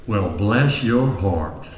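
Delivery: mild Southern lady, sweetly slow; Southern drawl. Acted. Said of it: "soft-spoken woman from the South".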